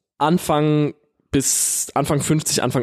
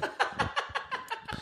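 breathy laugh; 16 dB quieter than usual. Real, not acted. Laughing mid-story.

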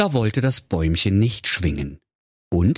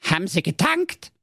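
grumpy dwarf; 11 semitones higher than usual. Acted. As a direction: drag it out sulkily, like a surly dwarf. Dwarf voiced in fairy tale.

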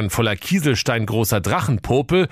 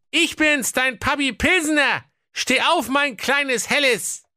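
very high, squeaky king voice; a silly old king, pitched way up; comic king voice. Acted. (hohe quäkige Königsstimme)

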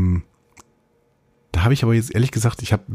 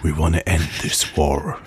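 lower, slow epic movie trailer voice; 4 semitones lower, slower than usual. Acted. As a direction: intone it like a blockbuster trailer. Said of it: "Ich hab auch eine Trailerstimme jetzt".

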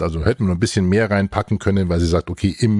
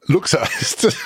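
ungläubiges Echo; 7 semitones higher than usual. Real, not acted. Wiederholt ungläubig.

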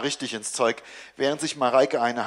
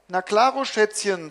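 put-on higher, sugary coo to the child; 7 semitones higher, 3 dB louder than usual. A narrator's reading. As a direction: sweeten it up to hide the panic. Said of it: "säuselt Mareike". (höher, süßlich säuselnd)

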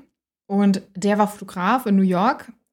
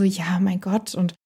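whisper. Retold or imitated. Ex dismissing it, unvoiced.

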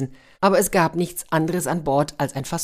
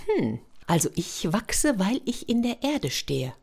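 Fräulein Orchidee; 6 semitones higher, 4 dB quieter than usual. Acted. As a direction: gently explain like a delicate lady. Orchidee explaining.

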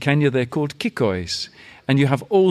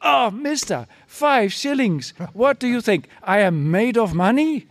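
brassy gum smacking floozy; 9 semitones higher than usual. Retold or imitated. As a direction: squeal in playful indignation. Mama outraged at low offer.

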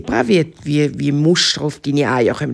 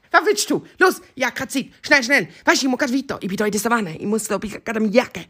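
impatient, raised-pitch cheetah voice; hurried cheetah; 8 semitones higher, faster than usual. Acted. Cheetah busy hunting, impatient and hurried. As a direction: hurry the visitor along in short bursts.

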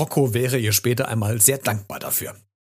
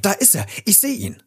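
high, excited shout of discovery; spotting someone and crying out; excited call; 9 semitones higher than usual. A narrator's reading. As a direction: call it out, pitch leaping up. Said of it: "rief Rotznase".

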